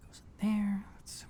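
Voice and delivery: whispered aside to himself while fitting the tape. Real, not acted. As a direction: breathe it under your breath.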